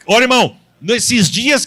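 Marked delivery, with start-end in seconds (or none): none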